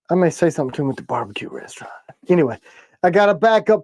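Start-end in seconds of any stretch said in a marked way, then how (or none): 0.1-1.9 quick hushed aside; whisper. Real, not acted. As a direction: drop to a secretive whisper. Quiet aside about a person.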